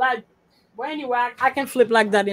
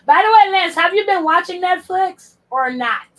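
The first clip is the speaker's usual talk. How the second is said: loud, higher call across the room; 7 semitones higher, 8 dB louder than usual. Real, not acted. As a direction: shout over to Lance. Calling out to Lance.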